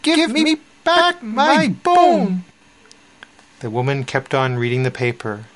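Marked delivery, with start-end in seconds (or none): 0.0-2.4 high, slow, insistent little voice; small voice getting angry. A narrator's reading. Small voice demands its bone, high and starting to get angry. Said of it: "It was beginning to sound angry".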